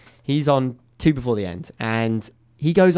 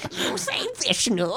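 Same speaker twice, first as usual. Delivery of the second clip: high, slow goblin, softer than usual; 9 semitones higher, 5 dB quieter, slower than usual. Pretend. Goblin appearing from the back of the room, goblin voice. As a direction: squeeze out a slow, high creature voice. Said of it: "a goblin will appear from the back".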